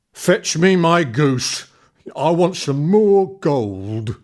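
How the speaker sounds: loud, slow booming giant command; demanding giant. Acted. Giant demanding his goose from the old woman, booming. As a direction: bark the order slowly. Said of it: "then demanded".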